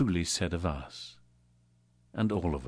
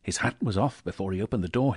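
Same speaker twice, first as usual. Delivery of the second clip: usual speaking voice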